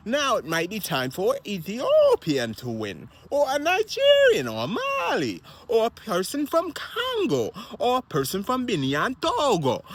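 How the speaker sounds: high, emphatic list with punched country names; rousing country roll call. Real, not acted. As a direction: build excitement, sending each country name soaring. Cheering on African marathon runners by country.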